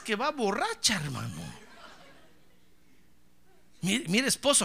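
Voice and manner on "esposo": ordinary talk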